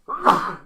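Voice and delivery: loud, sudden sneeze; real sneeze. Real, not acted. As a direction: sneeze hard after announcing it. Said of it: "I'm gonna sneeze".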